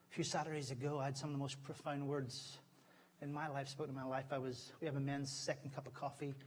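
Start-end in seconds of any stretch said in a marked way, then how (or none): none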